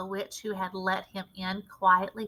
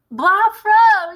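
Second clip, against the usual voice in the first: much higher, much louder cheer; yelling; 11 semitones higher, 11 dB louder than usual. A narrator's reading. Witch yells in delight at her leader. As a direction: cheer at the top of your voice. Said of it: "yelled the other".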